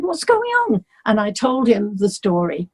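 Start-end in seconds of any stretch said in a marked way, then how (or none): 0.0-0.8 much higher, caring question. Retold or imitated. [name] asking the crying singer, concerned. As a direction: ask gently what's wrong. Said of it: "he said".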